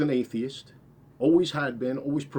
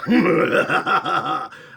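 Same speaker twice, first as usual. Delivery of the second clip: taunting laugh; 5 semitones higher, 8 dB louder than usual. Retold or imitated. Scott laughing in his head.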